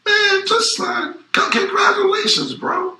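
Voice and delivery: high, loud mocking cheer; sarcastic congratulations. Rapper mocks a rival's emotional story with sarcastic, high, loud congratulations. Mock congratulations over signing deal.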